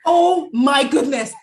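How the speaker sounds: astonished, loud and high, drawn out; thrilled disbelief, each word stretched; real amazement. Real, not acted. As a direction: marvel slowly at the coincidence, loudly. Amazed at the coincidence.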